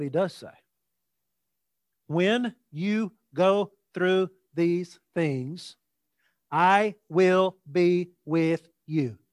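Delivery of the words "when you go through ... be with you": word by word, with spaces between; slow emphatic reading. Real, not acted. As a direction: set down each word slowly and deliberately. Stating what he does say.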